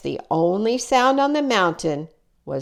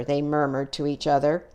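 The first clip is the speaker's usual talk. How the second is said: usual speaking voice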